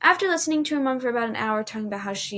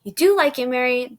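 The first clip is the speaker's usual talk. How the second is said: much louder, teasing insistence; insistent; 3 semitones higher, 8 dB louder than usual. Acted. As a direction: push it on her playfully. Jill insisting.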